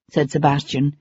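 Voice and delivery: plain reading voice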